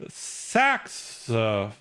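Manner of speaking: drawn-out "saxophone", broken into separate syllables; very slow, halting "saxophone"; misplaced stress demo. Shown as a demonstration. Streamer testing the stress rule, "saxophone" slowly pulled apart. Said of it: "you'll pronounce it on the".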